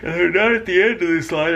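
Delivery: words pulled out by a yawn. Real, not acted. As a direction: talk through a yawn. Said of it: "I keep yawning".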